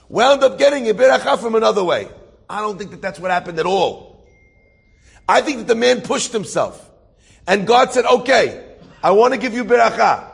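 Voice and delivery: loud, high-pitched sermon delivery; impassioned preaching. Real, not acted. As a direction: drive the moral home with force.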